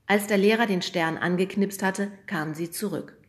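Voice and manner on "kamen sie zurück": usual speaking voice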